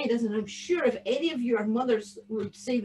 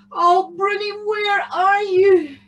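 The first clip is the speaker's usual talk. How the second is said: high, slow, loud lament; plaintive longing; 9 semitones higher, 6 dB louder than usual. A narrator's reading. Tam longs for his missing cow, plaintive. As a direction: wail it out yearningly. Boy pines for lost cow.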